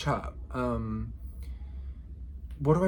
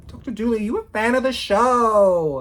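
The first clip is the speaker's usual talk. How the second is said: playful call-out; 8 semitones higher, 7 dB louder than usual. Retold or imitated. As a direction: holler it teasingly, stretching the last words. Repeats the joke, calling out.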